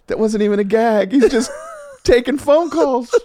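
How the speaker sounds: high, laughing disbelief. Retold or imitated. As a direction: crack up at the absurdity. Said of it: "I'm like".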